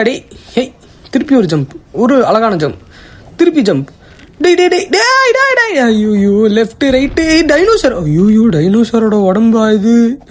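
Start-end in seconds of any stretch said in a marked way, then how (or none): 4.4-5.7 panicked high yelling. Real, not acted. Panicked gamer yelling at character.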